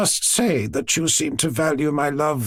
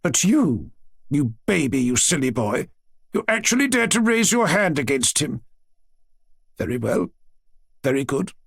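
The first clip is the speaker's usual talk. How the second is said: rehearsed scolding speech from a grandmother; formal, reproachful lecture; prepared reproach; 5 semitones higher than usual. A narrator's reading. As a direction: deliver a prepared rebuke, pitch climbing on the accusations. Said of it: "tone which clearly showed that her speech had been prepared".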